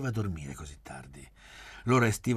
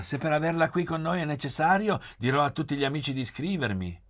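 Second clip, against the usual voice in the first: high, raised-voice call from a window; 9 semitones higher, 5 dB louder than usual. A narrator's reading. Gabriella to Oreste from the window, calling out. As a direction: call down to someone outside, higher and louder. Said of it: "Gabriella gli gridò dalla finestra".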